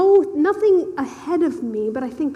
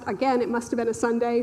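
usual speaking voice

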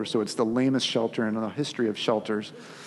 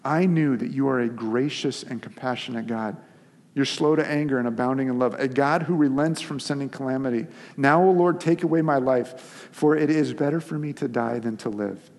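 dramatic scripture reading; 2 semitones higher, 4 dB louder than usual. Acted. Preacher reading Jonah's plea to die, building dramatic reading. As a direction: read Jonah's plea like a man begging to die.

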